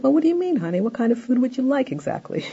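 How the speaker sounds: high-pitched, obliging questions; curious, cooperative mom. Acted. Mom answering child's polite request, curious and high. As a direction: sound sweetly curious and willing to help. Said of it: "Mom will probably be curious and willing to cooperate".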